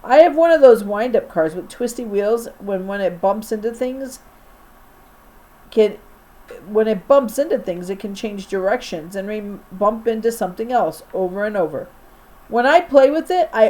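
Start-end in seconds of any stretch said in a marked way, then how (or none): none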